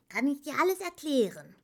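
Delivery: high, fast character voice; eager professor. A narrator's reading. Engywuck offers to explain everything to Atreyu, eager. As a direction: rattle it off self-importantly. Engywuck boasts of his research.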